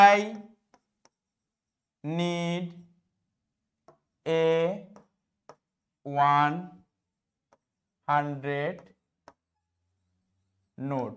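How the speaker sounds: slow, word by word, long pauses; slow dictation. Shown as a demonstration. Teacher dictating an example sentence to students, slow and spaced. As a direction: spell it out one word at a time, slow. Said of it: "যেমন".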